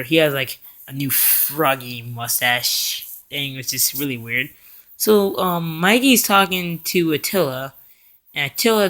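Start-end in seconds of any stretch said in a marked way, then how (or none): none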